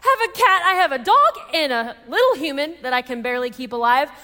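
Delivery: high, loud, hammed-up meltdown; retold hormonal outburst. New mother refusing a surprise cat, hammed-up frantic outburst. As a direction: act out losing it, voice shooting up. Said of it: "came out in a really ugly way".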